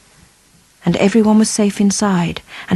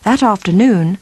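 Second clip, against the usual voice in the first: ordinary delivery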